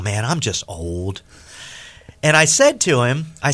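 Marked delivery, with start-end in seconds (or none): 0.0-1.2 slower and softer, gone gentle; an old man softening, quiet and slow; softened. Retold or imitated. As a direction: let the fight drain out of him. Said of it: "And he softens".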